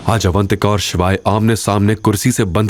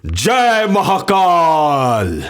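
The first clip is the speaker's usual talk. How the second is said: much higher, drawn-out very slow war cry; battle cry; 9 semitones higher, much slower than usual. Acted. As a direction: stretch the chant long before charging. Battle cry before attack.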